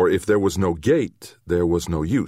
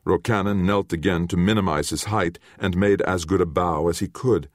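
ordinary delivery

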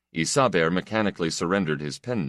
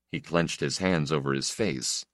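usual speaking voice

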